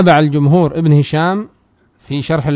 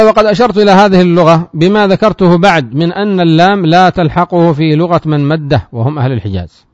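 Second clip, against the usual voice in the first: raised, louder reading of the matn; text recitation; 3 semitones higher, 4 dB louder than usual. Acted. Teacher reads the matn aloud to students, raised recitation. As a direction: declaim the source text to the class.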